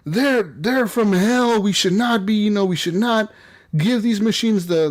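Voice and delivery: high-pitched fire-and-brimstone sermon; preaching pastor. Retold or imitated. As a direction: imitate a pastor rousing the flock against evil. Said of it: "He's, like, up there just preaching".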